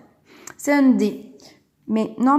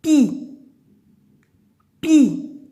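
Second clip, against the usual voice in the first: pi said higher and loud, repeated; syllable stressed; 5 semitones higher, 7 dB louder than usual. Shown as a demonstration. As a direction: hit pi clearly, higher. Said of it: "je dois écrire". (dire pi haut et fort)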